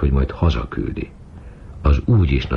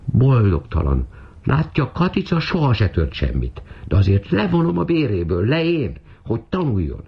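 very high-pitched, wailing reproach; mistress's squeaky nagging, no added volume; scolding; 9 semitones higher than usual. A narrator's reading. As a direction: chide the maid in a high, aggrieved voice. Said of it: "ő a háta mögött pirongatta".

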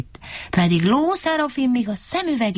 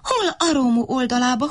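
usual speaking voice

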